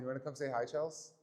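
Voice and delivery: normal talking voice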